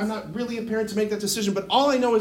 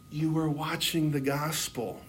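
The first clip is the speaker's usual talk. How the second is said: lower, softer, slow reply; calm wise mentor; 4 semitones lower, 5 dB quieter than usual. Retold or imitated. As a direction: answer gently, as a spiritual director. Wise director gently answers his outburst.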